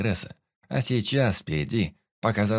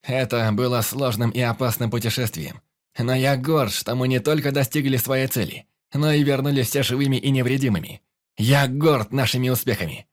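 raised, projected address to the crew; rousing captain's oratory; proud toast speech; 5 semitones higher, 4 dB louder than usual. A narrator's reading. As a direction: speak up proudly over the tavern.

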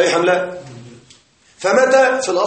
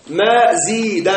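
slow repetition; much slower than usual. Shown as a demonstration.

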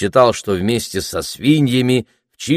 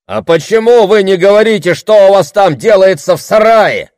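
high, loud heckle; irritated; 12 semitones higher, 8 dB louder than usual. A narrator's reading. Voice from the back rows challenging the orator, irritated. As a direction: call out crossly over the heads. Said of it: "раздался чей-то раздражённый голос из задних рядов".

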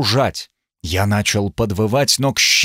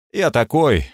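ordinary delivery, nothing marked

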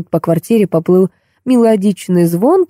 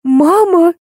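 squealing; 11 semitones higher than usual. A narrator's reading. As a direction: squeal it high in alarm. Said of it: "и взвизгнула". (взвизгнув, высоким голосом)

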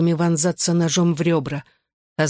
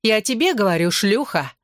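higher-pitched spiteful insult; venomous hiss; 5 semitones higher than usual. A narrator's reading. Bella insults the girl once more, with raised spiteful pitch. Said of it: "прошипела она".

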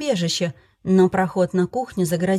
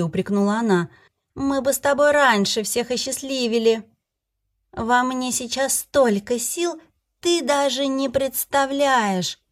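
tipsy fairy voice; 4 semitones higher than usual. Acted. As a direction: play the drunk fairy, elated. Fairy character voice.

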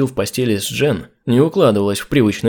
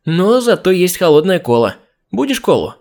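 from another room; 6 semitones higher than usual. A narrator's reading. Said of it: "прокричала из кухни Джин".

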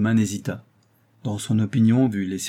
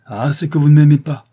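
loud, higher outburst of despair; despairing cry; 5 semitones higher, 11 dB louder than usual. A narrator's reading. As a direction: cry out as a rejected, desperate lover. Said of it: "s'écria-t-il avec l'accent du désespoir". (cri de désespoir)

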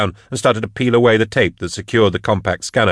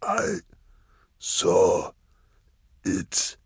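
soft, broken words with long pauses; ragged dying voice; 7 dB quieter, much slower than usual. A narrator's reading. Dying hunter confessing to Drusha, ragged and weak. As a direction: struggle out each word on ragged breath. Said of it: "his breathing was ragged".

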